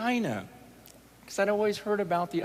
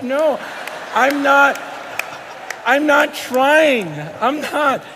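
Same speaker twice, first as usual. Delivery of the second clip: louder, higher denial, drawn out; insistent protest; 8 semitones higher, 7 dB louder, slower than usual. Retold or imitated. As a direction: insist hard you're not joking. Protesting he isn't joking.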